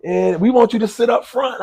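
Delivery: high, loud, excited reassurance; eager insistence. Retold or imitated. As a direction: insist warmly that he's the guest of honour. Friend insists he sits front.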